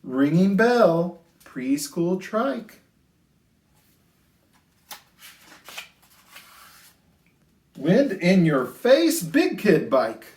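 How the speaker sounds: sing-song rhyming storybook read; lilting picture-book voice; reading to children. A narrator's reading. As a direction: read it gently to a class of little kids.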